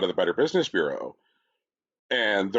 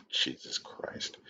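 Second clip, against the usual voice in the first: laughing whisper; 12 dB quieter, slower than usual. Real, not acted. Laughing aside.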